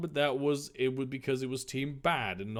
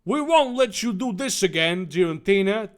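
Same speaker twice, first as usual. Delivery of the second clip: loud, high heroic challenge; theatrical hero's vow; defiant declaration; 6 semitones higher, 8 dB louder than usual. Acted. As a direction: shout down the villain. Heroes defy villain in story.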